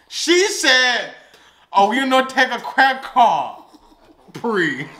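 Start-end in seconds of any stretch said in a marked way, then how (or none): 1.7-4.9 put-on caricatured Chinese accent, slow and drawn out; exaggerated Chinese-accented cashier; mock Chinese accent. Host correcting the storyteller's quote, mock Chinese accent. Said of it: "did not sound like that".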